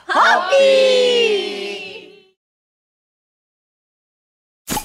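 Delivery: drawn-out toast shout. Real, not acted. Toast call at end of show.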